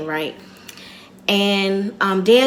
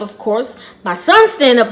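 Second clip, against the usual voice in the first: usual speaking voice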